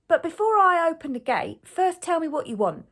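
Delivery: high, loud shout back; shouting gatekeeper. A narrator's reading. Gatekeeper demands answers from the ogre, shouting. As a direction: call out firmly through a gate. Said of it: "gatekeeper shouts back to the ogre".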